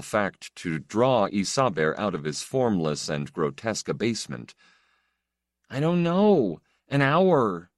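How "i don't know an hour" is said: through tears. Acted. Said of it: "He tried to stop sobbing".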